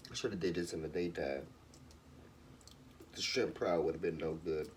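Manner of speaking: low, fast mutter under breath; muttered aside. Real, not acted. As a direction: mumble it to yourself quickly. Low muttered aside while cooking.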